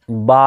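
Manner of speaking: "বা" with the aa held for one measure; এক আলিফ টান. Shown as a demonstration. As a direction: stretch the vowel of "বা" evenly. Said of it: "আবার বলছি দেখেন". ("বা" এক আলিফ টেনে)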